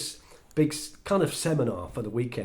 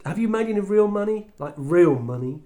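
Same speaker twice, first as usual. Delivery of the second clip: louder, higher, leaning on real; pushy challenge; 7 semitones higher, 6 dB louder than usual. Retold or imitated. Cocky speaker to Tish, loud challenge. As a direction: press her hard on real money.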